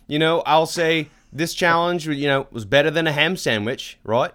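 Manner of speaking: loosely faked Australian accent, chummy slang delivery; hammed-up, badly done Australian; awful Australian accent. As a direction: put on a bad Aussie accent. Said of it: "that's an awful Australian accent".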